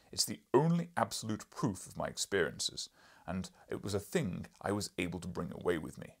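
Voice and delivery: loud, booming lecturing professor; gruff professor. Acted. Professor lecturing the journalist, loud and booming. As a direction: boom like an irritable scholar.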